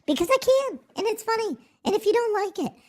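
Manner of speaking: very high falsetto; goofy, defiant falsetto; silly put-on voice. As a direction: squeak it out way up high. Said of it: "in this voice".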